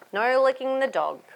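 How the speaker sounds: ordinary delivery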